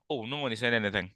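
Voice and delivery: ordinary delivery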